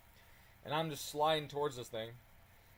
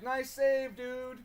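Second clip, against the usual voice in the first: cheery call; 9 semitones higher, 4 dB louder, much slower than usual. Retold or imitated. Thumbs up to semi driver.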